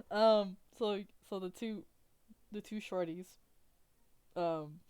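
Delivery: ordinary talk, nothing marked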